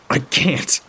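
high sharp shouted denial. A narrator's reading. Narrator shouting at Toggs, mild shout. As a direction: throw it at him. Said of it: "I shout at him".

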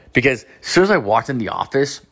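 normal talking voice

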